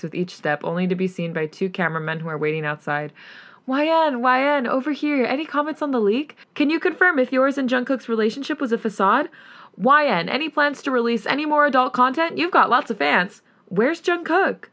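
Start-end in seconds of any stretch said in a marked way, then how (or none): 3.7-14.6 raised, higher pushy reporter calls; shouting paparazzi. Acted. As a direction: call out questions over each other.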